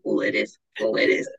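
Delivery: put-on accent declined. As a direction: say it low, without the accent. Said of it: "I'm not doing the accent".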